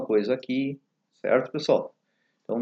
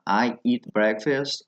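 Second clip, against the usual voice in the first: slow model phrase; much slower than usual. Shown as a demonstration. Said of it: "no inglês americano, volta e meia, eat".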